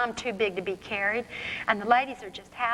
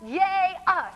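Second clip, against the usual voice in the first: high, drawn-out cheer; gleeful yell; 9 semitones higher, 4 dB louder than usual. Acted. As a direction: whoop it up like victorious kids. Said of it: "we yell".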